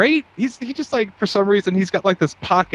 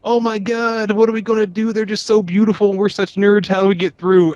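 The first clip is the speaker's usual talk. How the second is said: higher, louder flustered nerd voice; panicky nerds; 6 semitones higher, 4 dB louder than usual. Retold or imitated. Nerds in space fretting over beautiful people, flustered and higher. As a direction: fret like a nerd before the pretty girl, higher. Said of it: "It's like".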